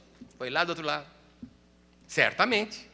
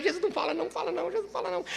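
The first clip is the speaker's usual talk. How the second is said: high, much softer begging; fearful pleading; 10 semitones higher, 6 dB quieter, much faster than usual. Retold or imitated. Preacher as a child begging Jesus not to speak, fearful. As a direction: plead softly, half afraid.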